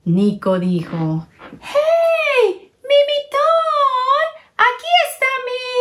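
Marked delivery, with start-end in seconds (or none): 1.6-5.7 very high, eager calling out; a kid spotting his lost mitten; thrilled little boy shouting; excited boy. Acted. Nico finds his mitten.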